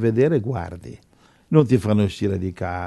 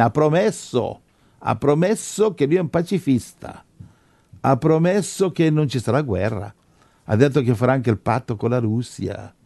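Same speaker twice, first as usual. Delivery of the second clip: raised-pitch, urgent sermon delivery; fervent preaching; 4 semitones higher than usual. Real, not acted. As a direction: preach it as a pressing warning. Preacher builds warning with history. (predicazione accorata, con tono alto)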